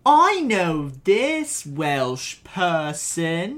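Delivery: very slow mock Welsh accent; drawn-out put-on Welsh voice. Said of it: "Me, right now".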